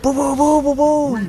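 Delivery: loud, high rapid booms; imitated grenade launcher. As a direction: fire off mock launcher blasts. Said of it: "That's the grenade launcher".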